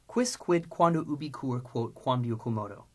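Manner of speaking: rapid memorization drill, descending in pitch; quick recitation. Shown as a demonstration. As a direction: reel off the list briskly. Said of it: "Now, three times quickly".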